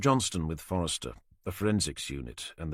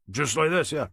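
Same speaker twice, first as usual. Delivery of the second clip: put-on old yard worker in a broad Norfolk accent, higher and louder; a Norfolk boatyard man answering the inspector; thick Norfolk accent; 8 semitones higher, 5 dB louder than usual. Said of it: "he said with a thick Norfolk accent".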